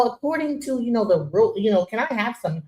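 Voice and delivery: soft, polite office voice; prim, quiet request, lightly put on; formal code-switch. Host showing how nobody talks at the barbecue, mock formal. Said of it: "We don't always talk like this".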